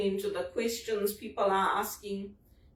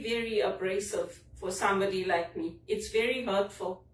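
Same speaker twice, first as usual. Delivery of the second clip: ordinary talk, nothing marked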